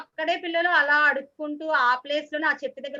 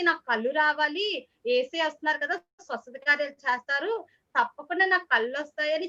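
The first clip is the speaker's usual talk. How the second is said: normal talking voice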